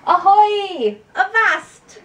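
loud, high pirate calls; pirate shouts. Shown as a demonstration. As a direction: yell them out like on deck. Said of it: "Those are good piratey exclamations".

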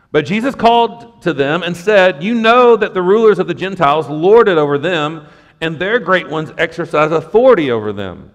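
loud, proclaiming Bible reading; emphatic scripture reading. Acted. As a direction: preach the text out to the room. Reading scripture aloud with force.

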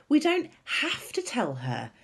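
high and eager, drawing out the last words; high, excited unveiling of a plan; excitedly. A narrator's reading. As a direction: savour the big reveal, thrilled. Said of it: "Sophie said excitedly".

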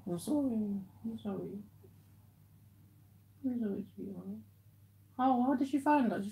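soft, quiet comforting; tender consoling. Real, not acted. Comforting his girlfriend whose cat died, soft and tender. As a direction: murmur sorry gently to her.

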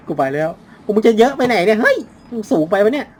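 loud, high laughing shouts; giggling yells at the monster; panicked laughing. Real, not acted. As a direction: laugh through the fright. Laughing panic while chased.

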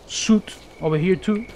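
ordinary talk, nothing marked

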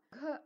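க with a burst of breath; aspirated k. Shown as a demonstration. Teacher showing the paper test, க strongly aspirated. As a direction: blow air out through க. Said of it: "அந்த paper ஆடும்".

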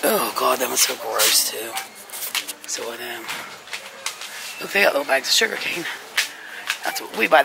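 ordinary delivery

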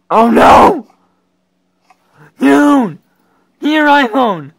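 loud, high panicked yells; alarmed outcry. Real, not acted. Player panicking at the screen, loud alarmed yells. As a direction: shout in mock panic.

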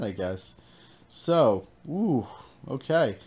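ordinary delivery

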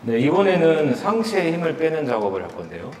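usual speaking voice

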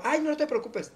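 high, airy never mind; breezy reassurance. Retold or imitated. Friend declining the jacket back, airy and high. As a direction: wave it off lightly and high. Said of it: "te la doy yo".

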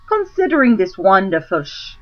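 ordinary delivery

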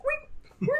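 very high, long "wee" squeal like a thrill ride; imitated joyful squeal. Friend retells body surfer's GoPro cry, very high squeal. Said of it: "was just like".

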